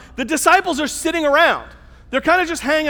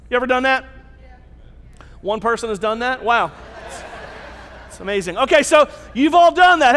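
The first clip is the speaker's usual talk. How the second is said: ordinary delivery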